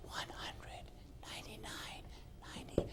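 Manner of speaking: voiceless whisper, close in her ear. Shown as a demonstration. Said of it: "And I whispered in her ear".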